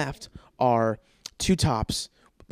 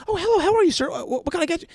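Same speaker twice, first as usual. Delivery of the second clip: much higher, exaggerated eager-to-please voice; fawning server; faster than usual. Waiter greeting a customer who is always right, mock fawning. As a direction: put on a squeaky, over-obliging waiter.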